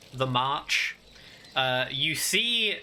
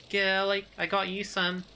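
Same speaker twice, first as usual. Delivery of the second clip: mock calling up; 7 semitones higher than usual. Calling up to Gil with food, higher mild mock shout. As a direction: call up in a higher character voice, without real volume. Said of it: "shouts up to Gil and says".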